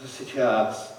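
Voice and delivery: barely above a whisper, distant and soft; a faint ghostly voice beckoning Alice; hushed coaxing. Acted. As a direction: lure her gently to sit and rest. Soft voice calling Alice to rest.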